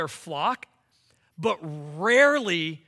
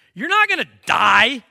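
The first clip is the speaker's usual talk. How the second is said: emphatic; 2 semitones higher, 10 dB louder than usual. Real, not acted. Preacher presses his point.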